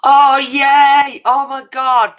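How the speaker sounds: real joy bursting out at the news; delighted squeal. Real, not acted.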